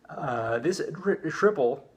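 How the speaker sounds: words catching in the throat; choking slightly mid-word; choking on something. Real, not acted. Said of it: "excuse me, I'm choking on something".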